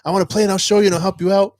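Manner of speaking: student eager to help teacher in the game; excited kid. Retold or imitated. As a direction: tumble out the words with excitement. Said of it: "he got so excited in the chat".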